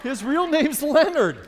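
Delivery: high, loud disbelief; comic outrage at the neighbor's fake name; incredulous. Real, not acted. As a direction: squeal the punchline in outrage. Punchline outrage at the alias.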